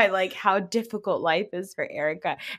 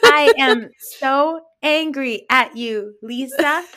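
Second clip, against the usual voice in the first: higher, louder, slow hammed-up fury; mock angry Whitney Rose; 4 semitones higher, 5 dB louder, slower than usual. Mocking a reality star scolding Lisa, hammed-up angry. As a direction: ham up a furious accusation, slowly. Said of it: "Whitney Rose sounds more angry than Erica".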